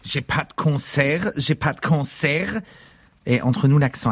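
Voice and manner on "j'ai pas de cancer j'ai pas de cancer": slow, strained higher voice, imitating a sick father; father with throat cancer. Comic imitates his father with throat cancer for the audience, in a slow strained higher voice. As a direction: mimic a throat-cancer rasp, slowly. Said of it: "Je peux vous imiter mon père qui a un cancer de la gorge".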